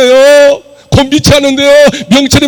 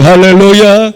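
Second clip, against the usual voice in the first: usual speaking voice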